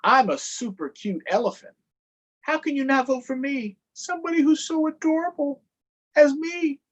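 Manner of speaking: high, cutesy elephant showing off. Acted. Elephant charming voters, high and cutesy. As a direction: sweetly brag about being adorable. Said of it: "He makes his adorable face".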